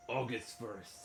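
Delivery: usual speaking voice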